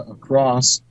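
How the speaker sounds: normal talking voice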